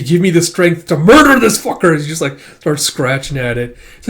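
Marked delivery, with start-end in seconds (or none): 0.0-2.0 loud, raised-pitch angry outburst; furious plea. Acted. Ren prays before attacking the Defiler, furiously. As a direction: build to a furious yell.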